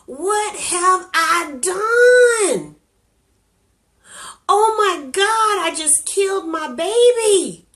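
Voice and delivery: high, loud crying scream of despair; anguished scream. Retold or imitated. Girl alone at home after the abortion, screaming and crying. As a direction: wail it out through tears, full voice. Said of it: "I began to scream at the top of my lungs".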